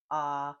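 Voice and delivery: ah held open like at the doctor. Shown as a demonstration. Teacher shows the open vowel, like at the doctor. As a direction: say ah, wide open mouth. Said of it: "like at the doctor".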